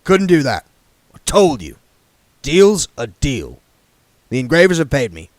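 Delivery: put-on the king turning down the young man's request; said shortly. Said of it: "said Harry shortly".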